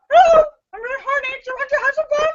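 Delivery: high, very fast squawking parrot voice; squawky parrot. Acted. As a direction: rattle it off like a parrot on repeat. Parrot repeats its come-on.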